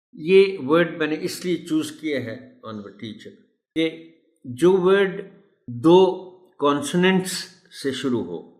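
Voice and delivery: ordinary talk, nothing marked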